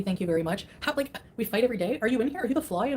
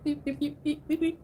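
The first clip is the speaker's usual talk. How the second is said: higher, mechanical beeping; little robotic beeps, pitched up; stapler guy impression; 7 semitones higher, 3 dB quieter, slower than usual. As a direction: beep like the mumbling office guy. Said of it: "sounds like the guy from Office Space with the stapler".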